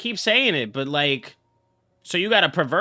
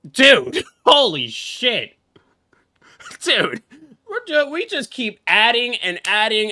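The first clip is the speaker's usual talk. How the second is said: high, louder exclamations with a laugh; shocked; 7 semitones higher, 5 dB louder, slower than usual. Real, not acted. Streamer reacting to the daughter's reveal, shocked and loud. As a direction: react like the plot just blindsided you.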